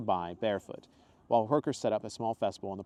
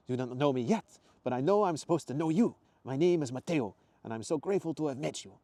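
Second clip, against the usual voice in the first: eager Italian man, raised pitch; grateful, emphatic Italian accent; 6 semitones higher, 4 dB louder than usual. Acted. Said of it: "his faded Italian accent".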